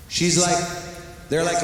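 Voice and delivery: ordinary talk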